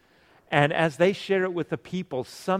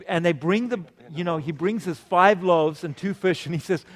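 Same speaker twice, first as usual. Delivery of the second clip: ordinary talk, nothing marked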